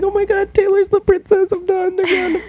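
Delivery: very high squeaky fangirl voice; hammed-up falsetto gushing; gushing fan. Reading out Taylor's positive comments, squealing fan imitation.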